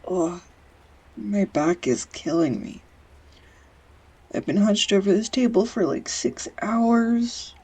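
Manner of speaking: milk the complaint; whiny complaint. Acted. Complaining about her back.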